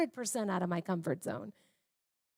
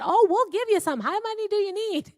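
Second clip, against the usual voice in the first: much higher, bright and delighted; eager offer; 12 semitones higher, 8 dB louder than usual. Retold or imitated. Author happily offers a neighbor more books, high and delighted. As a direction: gush with eager generosity.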